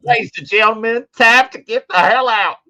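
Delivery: high, loud mock store announcement; loudspeaker announcer. Clerk telling shoppers it's time to go, loudspeaker voice. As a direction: blare it out like a closing-time announcement. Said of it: "going on the loudspeaker to, to tell people".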